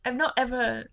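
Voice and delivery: high, put-on British voice; mock British phrasing. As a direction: mimic a Brit saying "I've not ever". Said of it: "no, we don't say that".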